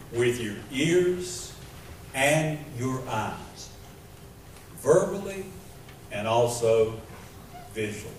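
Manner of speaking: usual speaking voice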